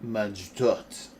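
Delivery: careful French pronunciation attempt; attempted French accent. Shown as a demonstration. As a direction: say it with your best go at French. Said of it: "that's my best French".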